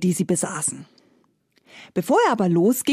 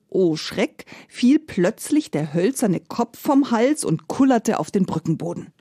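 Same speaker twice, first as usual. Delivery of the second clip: ordinary delivery, nothing marked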